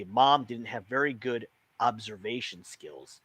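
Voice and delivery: plain reading voice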